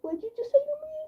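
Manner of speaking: high, indignant what-did-you-say; shocked squeak at his remark; shocked high retort. Retold or imitated. Retorting to him after he called her bad kitty, shocked and high.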